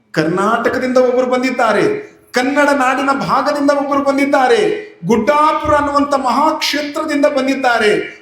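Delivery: high, ringing sermon proclamation; preacher heralds the holy mother, high and ringing; preaching at full force. Acted. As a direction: proclaim her arrival to the crowd.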